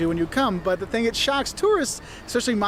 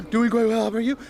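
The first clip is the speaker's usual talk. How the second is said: inhaled speech; 3 semitones higher than usual. Shown as a demonstration. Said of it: "going in and out".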